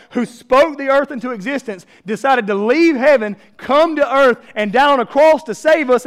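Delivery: loud, rising preacher build to a peak; fired-up sermon climax; preaching at full force. Acted. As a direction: preach it big and loud to the crowd.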